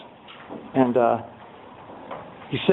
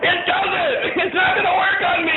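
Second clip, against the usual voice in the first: mock sponsor shout; 12 semitones higher than usual. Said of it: "sponsor mocking crying".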